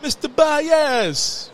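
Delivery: put-on stretched Jamaican accent hail across a restaurant. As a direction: call out the name slowly in a Jamaican accent. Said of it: "with the deepest Jamaican accent".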